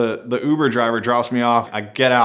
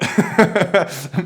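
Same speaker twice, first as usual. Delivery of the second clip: short laugh; 9 semitones higher, 3 dB louder than usual. Real, not acted. Laugh after joking advice.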